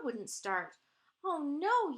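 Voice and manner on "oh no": higher, raised cry of dismay; mild yell. A narrator's reading. Mother finds the car won't start, mild dismayed yell. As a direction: exclaim it in storybook alarm. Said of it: "yelled Loretta's mother".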